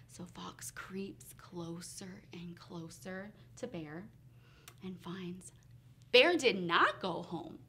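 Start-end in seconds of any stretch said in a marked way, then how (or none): 0.1-5.5 low, near-whispered suspense; hushed narration. A narrator's reading. Storyteller as Fox creeps up on Bear, hushed and low. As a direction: creep along in a hush.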